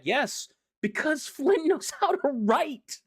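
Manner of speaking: high, slow, gleeful fangirling with a laugh; gushing praise. Real, not acted. Fan gushing to viewers about the writer, high and delighted. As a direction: rave about the writer you love.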